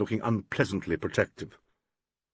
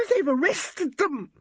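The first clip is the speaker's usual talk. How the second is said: high, slow hysterical cry; a young woman in panic at an arrest; hysterical scream; slower than usual. A narrator's reading. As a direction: shriek it in panic. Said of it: "screamed Valerie Gaines".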